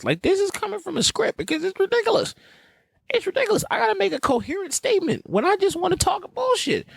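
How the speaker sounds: very high-pitched exasperation; exasperated complaint. Real, not acted. Host griping about having to read a script, exasperated. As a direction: whine it way up high.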